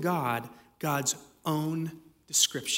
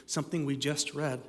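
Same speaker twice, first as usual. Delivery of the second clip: ordinary talk, nothing marked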